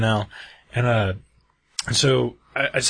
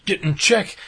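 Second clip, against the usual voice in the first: angry demand; 7 semitones higher than usual. Retold or imitated. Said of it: "he's like".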